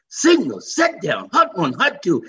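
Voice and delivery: loud, high, building excitement; excited play call. Real, not acted. Storyteller reliving his first carry, loud and excited.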